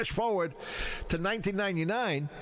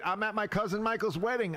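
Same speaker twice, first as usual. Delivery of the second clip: usual speaking voice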